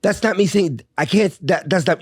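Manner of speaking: high, sputtering retold objection; indignant protest. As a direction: protest in disbelief, breaking off mid-thought.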